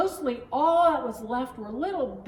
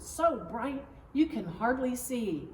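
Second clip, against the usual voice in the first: ordinary delivery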